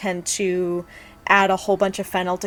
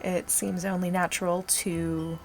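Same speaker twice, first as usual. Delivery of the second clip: low, sultry ASMR voice; 5 dB quieter than usual. Real, not acted. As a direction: speak low and intimate, ASMR style. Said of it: "talking in this, uh, low, slightly sultry voice".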